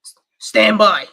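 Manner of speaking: loud, slow announcer-like impression; loud, drawn-out catchphrase impression; Richie from Boston. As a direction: boom it out slowly, in imitation. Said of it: "my Richie from Boston voice".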